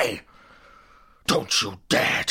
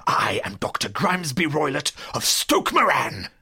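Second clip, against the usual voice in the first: loud, pompous self-announcement; booming, overbearing old tyrant; blustering villain; 2 semitones lower, 5 dB louder than usual. Acted. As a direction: thunder out your name and title. Villain announces himself angrily.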